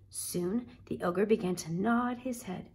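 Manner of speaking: ordinary delivery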